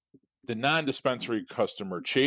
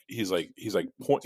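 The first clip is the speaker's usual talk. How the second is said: normal talking voice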